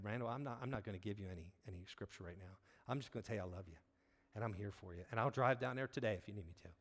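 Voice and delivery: soft, low and tender; retold in a hushed, caring voice; gentle reassurance. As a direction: quietly comfort a struggling friend. Retold reassurance to a struggling friend.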